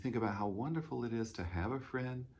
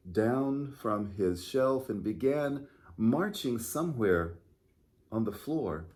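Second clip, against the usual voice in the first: storytime read-aloud, clear and lively; children's storytime narration. A narrator's reading. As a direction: read the picture book aloud to little listeners. Reads picture book to children.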